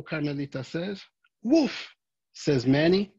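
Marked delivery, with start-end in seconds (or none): 1.4-2.0 short high playful woof; cheerful puppy woof; dog bark. Acted. Dog Manny barks.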